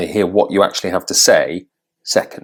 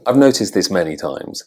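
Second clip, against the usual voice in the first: ordinary talk, nothing marked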